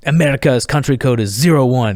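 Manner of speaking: imitated French accent; Jock. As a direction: give the dialling code as a Frenchman. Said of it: "and he goes, uh".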